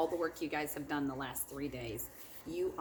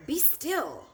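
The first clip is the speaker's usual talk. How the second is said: higher and louder firm command; a loud, stern "be still"; 13 semitones higher, 8 dB louder than usual. Acted. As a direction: stop the monsters dead with it. Max tames the wild things.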